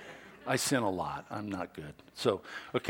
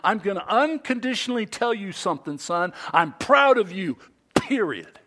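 proud dad; 9 semitones higher, 6 dB louder than usual. Shown as a demonstration. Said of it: "a dad saying".

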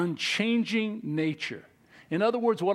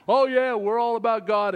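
mock boast; 5 semitones higher, 6 dB louder than usual. Mocking fickle people's boast.

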